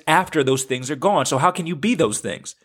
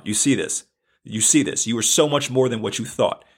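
ordinary talk

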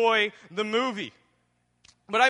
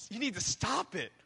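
soft warning; 7 dB quieter than usual. Shown as a demonstration. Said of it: "And if that's you".

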